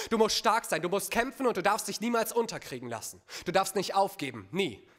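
insistent fatherly exhortation, pressing each command; emphatic, mildly forceful pep talk; stern urging. Acted. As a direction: drill it into your child, phrase on phrase. Voices father's hardening exhortations.

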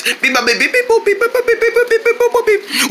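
rapid high-pitched electronic beeping; imitated button beeps. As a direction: beep like buttons mashed one after another. Imitating pushed remote buttons.

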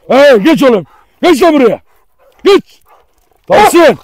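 loud, high shouted orders; shouted commands. Real, not acted. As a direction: shout orders at an unruly dog. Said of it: "Bağıra bağıra artık sesim boğazım acıyor". (bağırarak emir verme)

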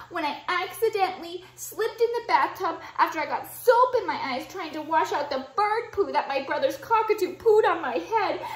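loud, high, fast breathless complaining list; overwhelmed child venting, laughing through it; crying kid's rant. Acted. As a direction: blurt out every woe without stopping. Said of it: "why Sam is crying".

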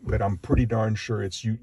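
ordinary delivery

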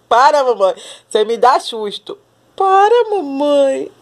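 high, loud squeal as the cat; voicing the startled cat. Voicing the cat startled by mom, loud high squeal. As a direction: cry out as the scared cat.

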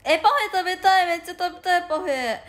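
high, loud parfait plea; excited craving. Real, not acted. Craving parfait on stream, loud high plea.

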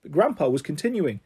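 ordinary delivery, nothing marked

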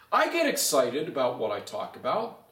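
normal talking voice

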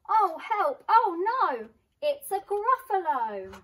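high, very loud panicked cries; fluttering cries for help. Acted. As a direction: squeal in sudden terror. Mouse meets the gruffalo.

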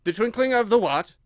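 high, loud, incredulous question; baffled king. A narrator's reading. King questions the rambling Hatter, loud and baffled. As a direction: query it in sharp disbelief.

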